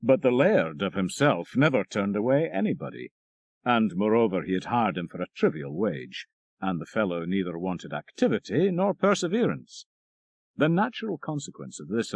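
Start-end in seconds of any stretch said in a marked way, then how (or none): none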